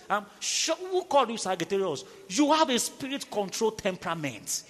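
loud, driving preacher's push; fiery sermon delivery; preaching at full force. Acted. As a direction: hammer it home to the congregation.

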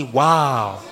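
long, low drawn-out wow; stretched, put-on amazement; mock smitten wow. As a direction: imitate someone lightly swooning. Voices a smitten woman's reaction.

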